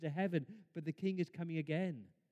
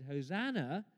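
ordinary talk, nothing marked

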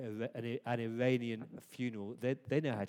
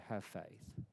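soft gentle command; 5 semitones lower, 10 dB quieter than usual. Acted. Jesus' words, said softly.

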